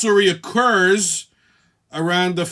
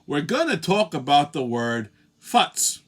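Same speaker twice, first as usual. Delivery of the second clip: ordinary delivery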